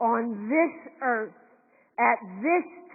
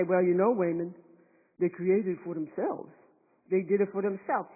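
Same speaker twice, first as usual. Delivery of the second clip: lower, dismissive quoting of critics; mock dismissive voice; 7 semitones lower, 3 dB quieter than usual. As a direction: mimic them with a put-down tone. Mocking what some people say.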